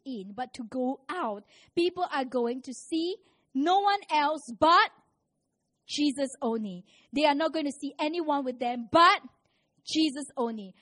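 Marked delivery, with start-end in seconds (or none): 1.7-6.8 emphatic preaching. Real, not acted. Preacher building to emphatic climax. 7.1-10.8 emphatic preaching. Real, not acted. Preacher repeats, louder climax.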